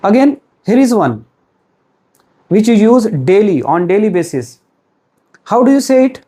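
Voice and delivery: normal talking voice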